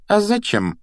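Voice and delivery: high, pointed question; suspicious query. A narrator's reading. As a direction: ask it warily, pitch lifted. McGonagall suspicious, asks why.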